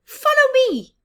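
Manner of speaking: very high, loud squeak; squeaky mouse. Acted. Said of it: "squeaked the mouse".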